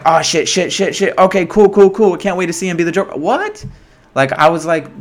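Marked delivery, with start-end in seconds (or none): 0.1-3.2 higher, rapid-fire excited muttered reaction; hyped-up retelling, words tumbling out higher; excited anticipation. Retold or imitated. Re-enacts his excited reaction watching. 3.2-3.7 very high, rising incredulous yelp; squeaky, put-on "huh?" reaction; mock disbelief. Re-enacts his let-down disbelief.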